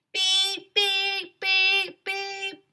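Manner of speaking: high, loud mock alarm beeps; high, even electronic beeps; imitated beeping. A narrator's reading. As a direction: beep like a smoke detector. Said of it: "went the smoke detector".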